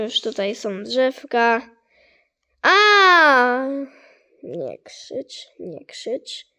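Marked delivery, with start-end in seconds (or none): 2.6-3.7 loud high startled scream; sudden scream. Real, not acted. Gamer screaming at a scare. 4.4-6.5 much softer, lower hushed plea. Real, not acted. Gamer hushing himself because of a sore throat. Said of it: "Gardło boli".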